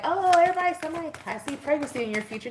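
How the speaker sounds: high, fast mock-mystical reader voice; mocking tarot readers. Mocking other tarot readers who predict pregnancy for everyone, hammed-up. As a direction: send up the cliche prediction. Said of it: "Everybody's like".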